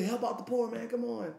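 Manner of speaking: pleading. Retold or imitated. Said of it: "He say".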